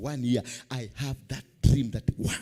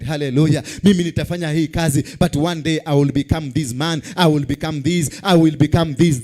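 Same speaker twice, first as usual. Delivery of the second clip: loud preaching; tv preacher; 11 dB louder than usual. Acted. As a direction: preach it out full force to the crowd. Preaching at full force.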